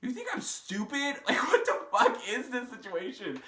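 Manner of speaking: half-laughing, half-furious, throw it at him; retold indignant outburst; mock outrage. Retelling her outraged reaction.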